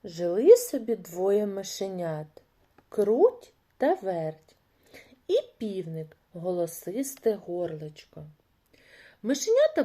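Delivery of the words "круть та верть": ordinary delivery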